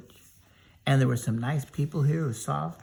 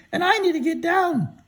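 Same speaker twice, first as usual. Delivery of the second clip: high, loud cartoon scarecrow; storyteller voicing a high silly scarecrow; 5 dB louder than usual. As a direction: pitch way up and call out as the scarecrow. Scarecrow stuck on a pole.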